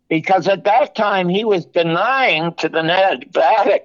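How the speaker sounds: usual speaking voice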